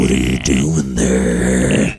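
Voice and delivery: very low, slow put-on voice; mock seductive. Trying erotic audio, low mock seduction. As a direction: stretch it out deep and creepy, joking.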